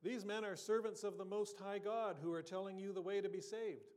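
crying out, high and loud; possessed girl shouting in the market. Retold or imitated. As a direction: call it out across the marketplace. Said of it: "her cry was".

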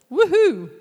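loud high whoop; a big joyful whoop to the room; cheer. Real, not acted. Wife cheering to the women about being a reward, loud whoop.

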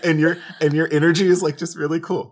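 high, loud, giddy self-mockery; starstruck rambling. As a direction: babble nervously, then hurry to get away. Acting out meeting an idol in chat.

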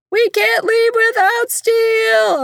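high, slow mock child whine; mother mimics her daughter's sassy whine; imitating sassy daughter. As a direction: mimic the sassy little girl pleading. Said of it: "Katie Jane is like".

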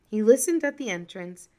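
usual speaking voice

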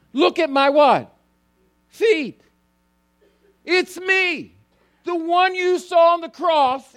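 high, loud, pleading "look at my hands"; the risen Jesus urging his friends to look; impassioned Jesus. Acted. Preacher voices Jesus showing wounds.

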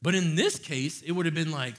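ordinary delivery

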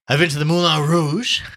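high, loud, expansive advice; worldly Gallagher, urging. Acted. Gallagher urges Tommy off to London or Paris, expansive. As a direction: boast like a well-travelled pal.

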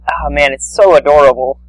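ordinary talk

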